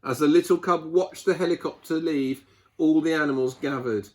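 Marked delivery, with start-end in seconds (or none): none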